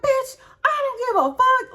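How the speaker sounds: high, loud angry yell; fly off the handle at full volume; going off. Retold or imitated. Mother cussing out the other woman on the phone, furious yell. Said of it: "I'm going off. I'm so mad".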